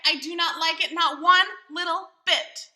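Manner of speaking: put-on child's loud protest. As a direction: declare it loudly. Child voiced in read story.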